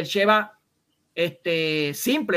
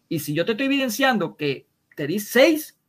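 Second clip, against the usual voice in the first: usual speaking voice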